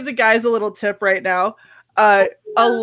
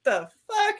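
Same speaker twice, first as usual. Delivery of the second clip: incredulous; 10 semitones higher, 8 dB quieter than usual. Retold or imitated. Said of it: "I was like".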